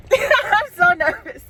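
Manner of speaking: high, slow, laughing through nerves; nervous laughter. Real, not acted. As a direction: giggle with anticipation.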